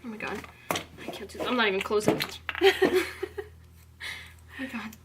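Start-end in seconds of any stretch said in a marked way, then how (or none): 0.0-1.5 breathy whisper, losing hope; a whispered I can't do this; whispered despair. Real, not acted. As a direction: whisper it to yourself, defeated. Panicking mid makeup challenge.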